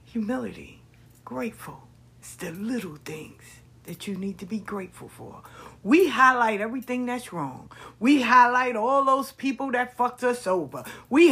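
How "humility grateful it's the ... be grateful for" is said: whispered, hushed reminder; inner voice whisper. Retold or imitated. An inner voice reminding her, whispered. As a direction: breathe it like a secret inner voice. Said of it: "something said".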